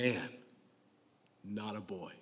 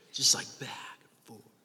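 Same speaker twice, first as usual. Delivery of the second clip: whisper; 6 dB quieter than usual. Retold or imitated. Mocking lovers' back-and-forth.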